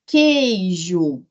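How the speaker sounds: "queijo" bem devagar, sílabas alongadas; pronúncia demonstrada. Shown as a demonstration. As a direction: estique "queijo" para o aluno escutar os sons. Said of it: "Você escuta esse U?". ("queijo" pronunciado devagar, sílaba por sílaba)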